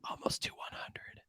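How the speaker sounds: breathy whispered aside. Real, not acted. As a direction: whisper it like a secret to the listeners. Aside about episode count.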